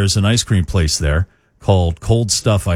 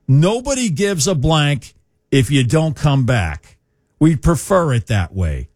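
put-on Masshole accent; 7 semitones higher than usual. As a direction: double down like a blunt Masshole. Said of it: "That is so masshole".

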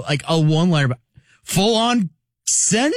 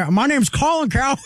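quick, higher radio-host voice; Colin Cowherd impression; 6 semitones higher, faster than usual. Guest imitating a sports radio host for laughs, quick and higher. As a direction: rattle it off like a sports talk host. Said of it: "That's my Cowherd, everybody".

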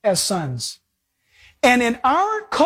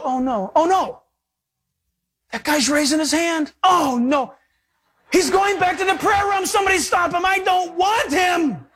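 high, hammed-up protest; mock dismayed God; 6 semitones higher than usual. God seeing a hand raised, mock dismay. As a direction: plead in mock alarm, stretching the last words. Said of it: "He doesn't go".

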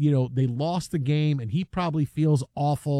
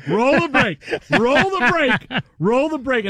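higher and louder, hurried commands to the booth; a frantic control room cue, high and loud; urgent producer call; 7 semitones higher, 5 dB louder, slower than usual. Retold or imitated. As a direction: bark it like a panicking producer cutting to commercial.

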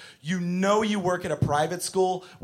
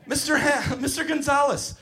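put-on high-pitched tattling child voice; whining preschooler; 9 semitones higher than usual. Child reporting Henry to Mister Gonzalez, high plaintive whine. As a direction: complain like a small kid to teacher.

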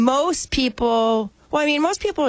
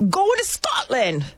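high, slow attempt at a Scottish accent; a bad stab at a Scottish accent, drawn out; 10 semitones higher, slower than usual. To her golf friends, announcing the trip in a bad Scottish accent. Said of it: "Is that a Scottish accent?".

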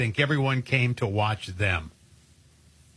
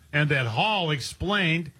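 ordinary talk, nothing marked

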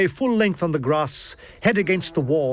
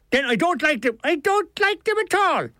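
high, indignant Dublin character voice; Dublin accent; 11 semitones higher than usual. A narrator's reading. Milligan complains to the author about his legs, indignant Dublin accent. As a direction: complain in Dublin tones, rising higher as it goes. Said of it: "It was a Dublin accent".

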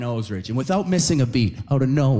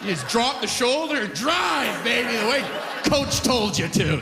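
coaching voice; 9 semitones higher than usual. Retold or imitated. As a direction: preach it like a football coach. Voicing a coach's instruction.

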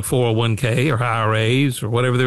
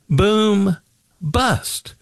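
sing-song boom bust; 6 semitones higher than usual. Acted. Mocking the boom-bust cycle.

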